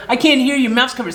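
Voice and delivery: mimicking the rude gas attendant; mocking attendant. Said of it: "He's like".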